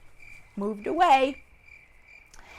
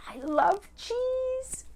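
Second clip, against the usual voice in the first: high, drawn-out, softer croon; longing wolf; 13 semitones higher, 4 dB quieter, much slower than usual. Acted. Wolf dreaming aloud of cheese, high slow longing. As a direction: savour each word slowly, up high.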